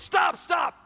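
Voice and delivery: high, loud mock yell of stop; coach shouting. Coach cutting off his quarterback, loud mock shout. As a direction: bark at the player to quit talking. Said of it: "He goes".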